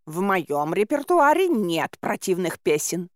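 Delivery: slow, put-on voice of an offended cat; affronted cat. A narrator's reading. Cat Bayun defends his repertoire, affronted. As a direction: protest with wounded artistic pride. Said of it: "вскинулся Баюн".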